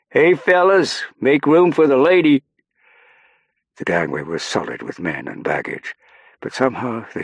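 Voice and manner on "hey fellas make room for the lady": high, loud call across a crowd. A narrator's reading. Stackpole to the men on the gangway, calling out loudly. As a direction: holler to clear a path. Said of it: "and called out".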